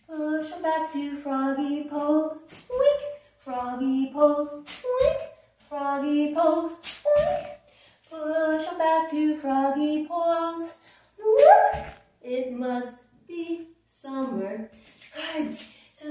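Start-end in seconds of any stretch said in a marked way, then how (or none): 2.7-3.0 much higher, louder little squeak; a cartoon frog's squeak; squeaky wink. Froggy pose sound effect. 4.5-5.2 a cartoon frog's squeak; squeaky wink. Froggy pose sound effect. 7.0-7.5 much higher, louder little squeak; a cartoon frog's squeak; squeaky wink. Froggy pose sound effect. 11.2-11.8 much higher and louder whoop; excited whoop. Pretend. Frog jump sound.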